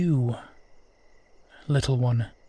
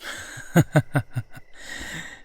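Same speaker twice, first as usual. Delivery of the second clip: breathy, amused chuckle; 2 semitones higher than usual. Acted. Amused at finally being asked.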